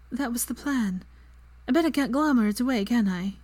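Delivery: high-pitched, mildly grumpy complaint. A narrator's reading. Girl groaning on her bed, grumpy. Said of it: "she said grumpily".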